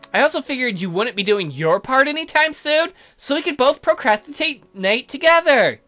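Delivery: high, loud, singsong cheer; gushing girlish invitation; perky girl character. Acted. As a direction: chirp it high and bright.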